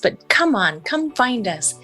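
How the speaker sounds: usual speaking voice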